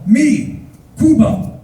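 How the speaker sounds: loud, high, simple declaration; proud introduction. Retold or imitated. Bus driver introduces himself to tourist, loud and high.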